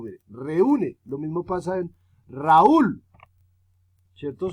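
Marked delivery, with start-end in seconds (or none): 0.3-1.0 "reúne" with a strong, raised U; "reúne", U harder than the E; stress on U. Shown as a demonstration. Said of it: "la que más suena duro es la U". 2.3-3.0 "Raúl" with a long, loud U; "Raúl", U far stronger than the A; stress on U. Shown as a demonstration. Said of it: "Suena más duro la U".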